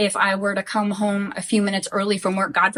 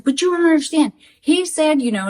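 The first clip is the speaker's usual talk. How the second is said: imitating an agitated protest, higher and louder; mock-livid pleading, pitched up; livid retelling; 6 semitones higher, 5 dB louder than usual. Husband protests to wife backstage at the show, livid. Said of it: "He was livid".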